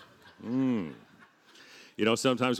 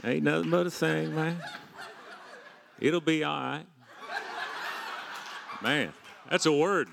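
put-on Southern accent; 6 semitones higher, 4 dB quieter than usual. Preacher shrugging off stress in a Southern accent. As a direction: lay on an easygoing Southern accent. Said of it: "I talk in a Southern accent".

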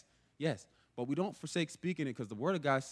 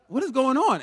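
higher and louder, alarmed; alarmed outcry; 10 semitones higher, 10 dB louder than usual. Retold or imitated. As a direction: burst out in alarm at the mirror. Bewildered at the mirror.

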